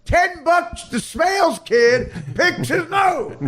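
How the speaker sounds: very high, hollered wager; shouted bet. Acted. Caddies bet on the Smales kid, gleefully shouting. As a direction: holler it like a rowdy caddy, stretched out.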